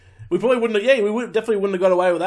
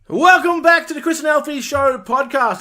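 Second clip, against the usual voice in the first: louder, higher show-opener voice; podcast host intro; 8 semitones higher, 5 dB louder than usual. Real, not acted. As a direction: announce the show with energy.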